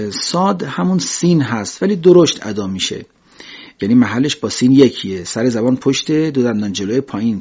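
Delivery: ordinary talk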